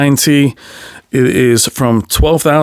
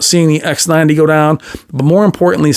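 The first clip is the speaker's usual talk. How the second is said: ordinary talk, nothing marked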